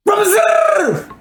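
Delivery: high, strained yelling into a phone; frantic shouting. Retold or imitated. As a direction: yell desperately for a human. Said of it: "all you gonna hear is me on the other side of the phone".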